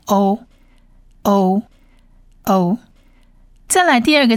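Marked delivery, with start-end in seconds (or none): 0.1-2.8 欧 said as a drawn long "oh"; long "o" sound. Shown as a demonstration. Teacher reads the vowel 欧 for learners, long "oh". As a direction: say 欧 as one clear long "oh". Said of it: "所以这个字就念欧".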